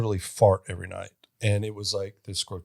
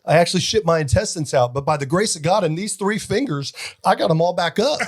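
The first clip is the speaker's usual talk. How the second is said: husband voiced higher and fast; high mock husband; 8 semitones higher, 5 dB louder than usual. Husband explaining to his wife, high hammed-up voice. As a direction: deliver the punchline as the frantic husband. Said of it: "He goes".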